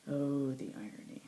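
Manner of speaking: ordinary delivery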